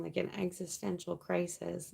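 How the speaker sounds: ordinary talk, nothing marked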